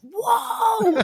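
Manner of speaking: long, high, loud whoa; awed crowd whoa. Retold or imitated. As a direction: imitate a whole theater gasping whoa. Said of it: "the whole theater just went".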